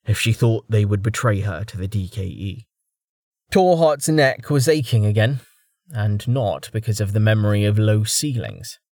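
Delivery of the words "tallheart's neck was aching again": ordinary delivery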